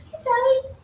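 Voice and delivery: excited pet greeting. Real, not acted. Greeting pets.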